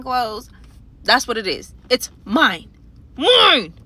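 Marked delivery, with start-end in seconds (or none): 1.9-3.7 snatch it back with a loud cry; possessive shout. Retold or imitated. Claiming the money.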